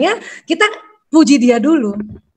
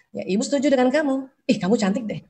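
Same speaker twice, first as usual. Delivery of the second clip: soft, much quieter motherly praise; gentle praise; 7 dB quieter than usual. Retold or imitated. A mother praises her child in a soft, gentle voice. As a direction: soften right down, like a mother reassuring her child.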